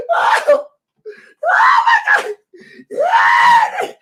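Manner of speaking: shrieking, tearful high laughter; laughing till crying. Real, not acted. Reacting to the woman's words, shrieking tearful laughter. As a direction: laugh until it turns into crying.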